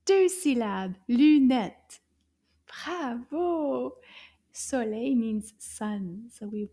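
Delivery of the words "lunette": "Lunette" in two loud, punched beats; clapped syllables. Shown as a demonstration. Teacher counts syllables with the class, "Lunette" clapped out loudly. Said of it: "Let's clap together".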